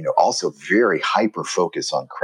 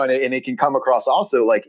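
ordinary talk, nothing marked

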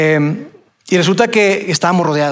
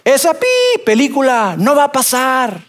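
distant shouting man; 6 semitones higher, slower than usual. Retold or imitated. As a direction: heckle them from across the field. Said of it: "se escucha la voz de una persona y dice".

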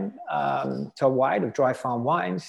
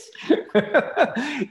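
high laugh after a silly bit; 12 semitones higher than usual. Real, not acted. Speaker amused by his own ketosis act, laughing. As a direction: laugh at your own hammed-up squeal.

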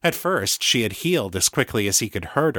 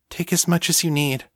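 lightly voiced thrall, higher pitch; reassuring thrall; 8 semitones higher than usual. Thrall offering blood to her mistress, mildly reassuring. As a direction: gently offer yourself, pitched up.

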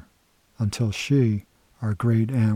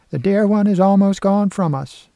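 put-on higher and louder old aunt lamenting; great aunt Walda; 10 semitones higher, 7 dB louder than usual. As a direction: sigh it out as a mournful old aunt. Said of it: "she would say".

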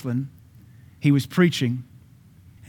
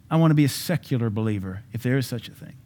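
normal talking voice

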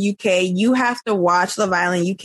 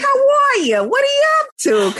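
hammed-up Nigerian dad with a Brooklyn twang; Brooklyn-African accent; faster than usual. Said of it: "a weird combination of Brooklyn and African".

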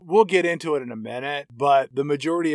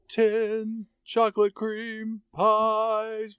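put-on quoting an old reference; 6 semitones higher, much slower than usual. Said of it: "If you get that reference".

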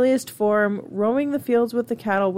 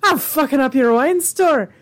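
louder put-on Irish accent; mock Irish clurichaun; 3 semitones higher, 3 dB louder than usual. Host voices clurichaun bragging about petty mischief, mock Irish accent. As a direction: crow it in a hammed-up brogue. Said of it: "the Clurichaun is just like".

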